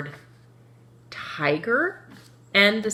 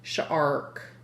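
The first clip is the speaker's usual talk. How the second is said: ordinary talk, nothing marked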